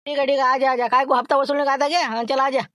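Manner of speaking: high, loud put-on thin voice; Laxman's thin voice. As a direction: squeeze the voice thin and high to pass as someone else. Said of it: "बरोबर बारीक आवाज काढतो".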